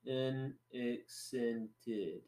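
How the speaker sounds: very slow, soft French-accented "unaccented"; French pronunciation. Shown as a demonstration. Showing a friend the French way, slowly in a French accent. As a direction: stretch "unaccented" out syllable by syllable with French vowels. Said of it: "They would say".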